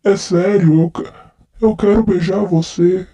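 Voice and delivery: lightly slurred, tipsy declarations of love; drunk man. Acted. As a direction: play a drunk guy sweet-talking his friend. Nossa, eu tô muito bêbado.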